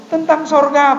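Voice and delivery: high, loud acted reply; emphatic informing. Preacher retells telling the man it was heaven, loud and high. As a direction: break the news, pitched well up.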